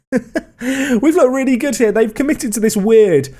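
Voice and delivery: laughing through the words; giddy laugh while speaking. Real, not acted. Laughing after the win.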